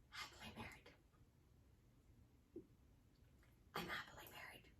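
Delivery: barely-there whisper with head down; deflated whisper. Shown as a demonstration. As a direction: let the words barely get out, defeated. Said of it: "soften your voice".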